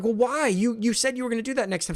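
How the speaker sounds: pushing her to explain herself; frustrated pressing. Retold or imitated. Husband to frustrated wife, exasperated.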